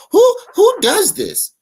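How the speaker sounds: high, loud, drawn-out disbelief; incredulous. Real, not acted. Commentator reacting to someone demanding money back, incredulous. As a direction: demand it slowly, pitched right up. Incredulous rant at clip's speaker.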